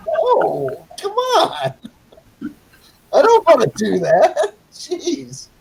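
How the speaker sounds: very high-pitched, whiny protest; mock exasperation. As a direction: plead in a squeaky mock complaint. Acts exasperated protest at dilemma.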